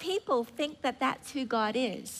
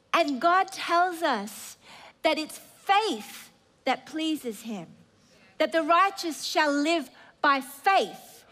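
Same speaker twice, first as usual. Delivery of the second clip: ordinary talk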